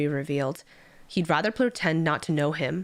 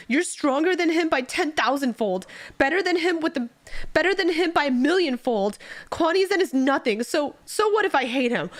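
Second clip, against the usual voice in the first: high, furious protest; crying out; 8 semitones higher than usual. Acted. Subordinate crying out over the unfair banishment, high. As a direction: rail at the injustice, pitched up. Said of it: "he cries out".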